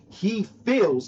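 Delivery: feels leaned on, drawn out; stress on feels. Shown as a demonstration. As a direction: hit the word feels hard. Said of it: "keyword feels".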